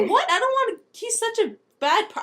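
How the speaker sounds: high, laughing objection; a high, cracking-up refusal; laughing protest. Acted. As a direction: blurt it while giggling.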